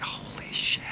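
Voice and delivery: breathy whisper, voice dropped; whispered realization. Retold or imitated. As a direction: fluister het alsof het net tot je doordringt. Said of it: "I was like".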